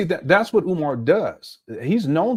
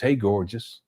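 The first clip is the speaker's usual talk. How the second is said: a hint of a low, soft flirt; smooth pickup line; 7 semitones lower, 4 dB quieter, slower than usual. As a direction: slip the compliment in lightly, like a player. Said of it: "That's running game".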